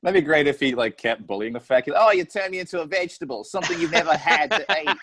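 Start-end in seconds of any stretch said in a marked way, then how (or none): 2.0-5.0 quick, higher Aussie taunt; teasing Australian kid, breaking into laughter; mock Australian accent. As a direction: rattle off a jibe in put-on Australian. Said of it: "that teasing Australian kid".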